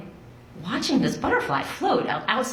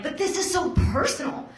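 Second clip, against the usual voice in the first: pleading protest; 9 semitones higher than usual. Retold or imitated. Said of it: "And I was like".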